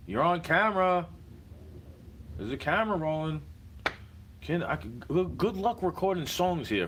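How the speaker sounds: high, loud heated outburst; angry rant. Real, not acted. Telling the neighbor the camera is rolling, loud and angry. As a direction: shout it at the neighbor, fed up. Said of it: "Just like that".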